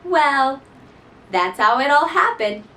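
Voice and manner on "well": plain reading voice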